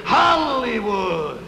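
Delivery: slow, loud announcement; drawn-out call. Acted. Scout announcing the trip to Hollywood, drawn-out call. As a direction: ring it out like a destination call. Calling out destination.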